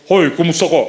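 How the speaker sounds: manly greeting. Retold or imitated. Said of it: "malalaking bo".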